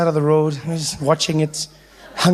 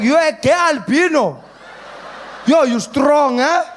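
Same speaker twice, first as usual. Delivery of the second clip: put-on drunk uncle; 7 semitones higher than usual. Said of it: "he's like".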